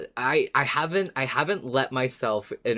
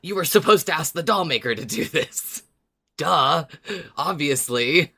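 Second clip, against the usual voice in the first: mock-snotty, higher, a touch louder; condescending Yorick; 5 semitones higher, 5 dB louder than usual. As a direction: talk down to them like it's obvious.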